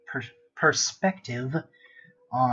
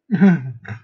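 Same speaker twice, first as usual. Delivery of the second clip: loud burst of laughter; 2 semitones higher, 11 dB louder than usual. Real, not acted. Laughs at own fear.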